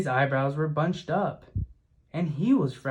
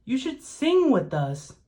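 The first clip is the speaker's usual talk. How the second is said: much higher, light and chirpy; sing-song invitation, pitched well up; lightly voiced birds, coaxing; 10 semitones higher, 3 dB louder than usual. A narrator's reading.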